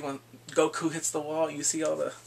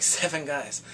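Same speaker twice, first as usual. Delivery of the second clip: ordinary delivery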